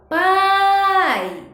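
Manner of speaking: "pai" with a long, loud, high A and brief final I; stressed vowel stretched. Shown as a demonstration. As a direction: hold the A of "pai" high, then let the I drop. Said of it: "teve maior tonicidade".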